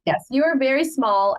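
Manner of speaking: usual speaking voice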